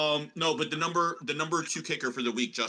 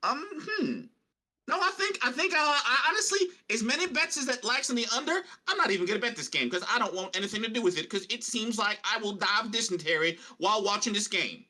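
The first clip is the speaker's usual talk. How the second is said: Deuce Chiggins; 4 semitones higher than usual. Pretend. Co-host refuses to bet this game, prospector voice. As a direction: think it over in a put-on prospector accent. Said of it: "prospector Deuce Chiggins".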